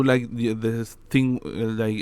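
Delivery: normal talking voice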